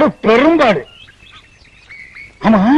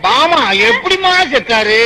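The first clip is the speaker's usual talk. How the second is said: high, slow wailing question; drawn-out comic wail; mock mourning; 6 semitones higher, slower than usual. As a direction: lament along with exaggerated grief. Wails along with the mourner.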